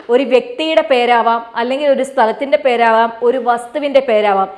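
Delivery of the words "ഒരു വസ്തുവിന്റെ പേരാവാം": ordinary talk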